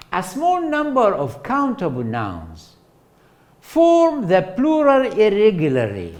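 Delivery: lightly formal lecture read; textbook reading voice. Acted. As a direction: read it off the slide like a formal definition. Reads definition from slide.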